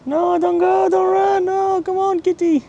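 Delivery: very high pleading kitty voice; high squeaky plea to a fleeing cat; pleading cat call. Real, not acted. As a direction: beg the cat not to run, way up high. Cat running away.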